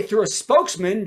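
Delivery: usual speaking voice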